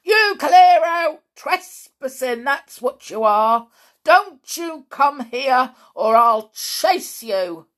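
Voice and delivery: put-on bellowing angry old man; husky bawl. A narrator's reading. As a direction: roar it out hoarse and slow. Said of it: "tried to bawl in his husky voice".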